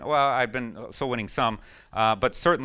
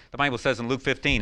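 ordinary talk

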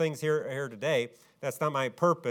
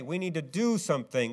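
usual speaking voice

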